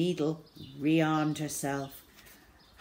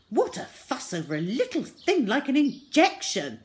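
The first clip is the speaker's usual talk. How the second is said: dismissive doctor; 7 semitones higher, 5 dB louder than usual. A narrator's reading. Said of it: "said Doctor Dettol".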